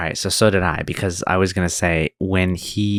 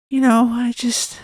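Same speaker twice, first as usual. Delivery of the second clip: put-on much higher, slow, childlike man's voice; attempt at the guy's voice; slower than usual. Asked to mimic the Blackbird guy's voice, high and childlike. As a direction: start the creepy high voice, then break off. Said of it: "can you do his voice real quick".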